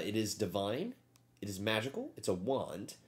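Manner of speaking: normal talking voice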